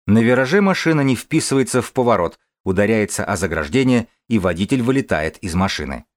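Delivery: normal talking voice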